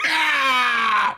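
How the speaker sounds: high, loud mock newborn wail; baby's first scream. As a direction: scream it like the baby just popped out. Baby born, screaming.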